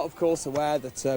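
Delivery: normal talking voice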